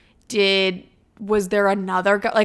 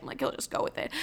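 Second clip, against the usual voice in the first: whisper; 3 semitones higher, 14 dB quieter, faster than usual. Retold or imitated. Inner thought quoted.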